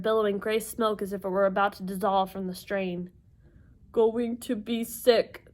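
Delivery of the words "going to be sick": slow, put-on queasy voice; queasy warning. Tyson sick in the swerving taxi, slow warning. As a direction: drag it out as if about to throw up. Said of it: "Tyson warned".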